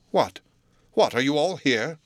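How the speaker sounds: testy, rising disapproval; peevish. A narrator's reading. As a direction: scold the household in irritated surprise. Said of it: "said the lawyer peevishly".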